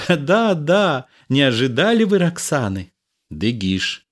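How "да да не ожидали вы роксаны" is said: high, quick, amused delivery; laughing. A narrator's reading. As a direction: tease them with a laugh in the voice. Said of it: "смеясь".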